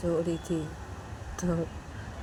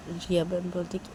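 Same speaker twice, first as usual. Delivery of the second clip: ordinary delivery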